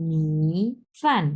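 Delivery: drawn-out dipping মি, then falling ফান; third then fourth tone. Shown as a demonstration. Teacher shows how it should sound, dipping মি then falling ফান. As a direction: hold the dip on মি, then drop ফান. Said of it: "এইরকম হবে".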